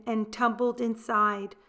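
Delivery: ordinary delivery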